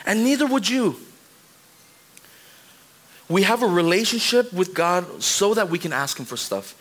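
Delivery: ordinary delivery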